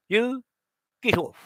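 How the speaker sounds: ordinary delivery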